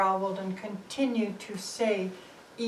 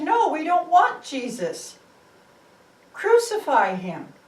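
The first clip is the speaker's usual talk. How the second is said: higher and loud refusal; crowd shout; 6 semitones higher, 8 dB louder than usual. Acted. The people reject Pilate's offer with a loud shout. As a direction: shout it down as the mob.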